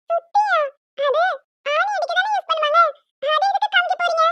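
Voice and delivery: put-on high, puzzled little girl voice; little child. Little girl puzzled, asking the aunt. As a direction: play a small child confused by the word.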